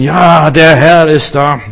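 loud, drawn-out imitation of pious enthusiasm; mock pious rapture. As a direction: hail the little cloud in exaggerated, slow rapture. Spielt fromme Begeisterung über Wölkchen nach. (übertrieben fromm verzückt, laut und gedehnt)

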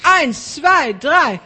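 high, loud shouted count. Shown as a demonstration. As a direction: shout the number out. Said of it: "Genau, lauter".

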